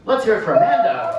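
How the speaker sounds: loud, high announcer cheer for Amanda; rally the crowd behind her; hype shout. Real, not acted. Comic calling the crowd to cheer Amanda, loud hype shout.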